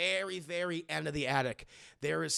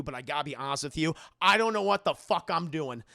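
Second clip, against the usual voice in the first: usual speaking voice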